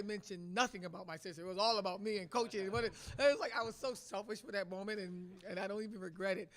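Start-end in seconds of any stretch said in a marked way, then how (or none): none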